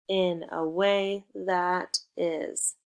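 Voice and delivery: slow, deliberate teacher pace. Shown as a demonstration. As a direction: spell it out slowly. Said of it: "teacher slow explanation".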